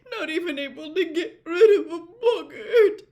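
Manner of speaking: high, tear-choked apology; shaky tearful. A narrator's reading. Missus Weasley apologises to Harry, shaky with tears. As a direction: try to steady a crying voice.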